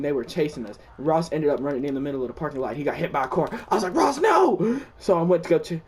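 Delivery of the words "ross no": high, loud cry of despair; hammed-up anguished shout; mock anguished cry. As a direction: call out as your friend goes down. Ross hit by a car.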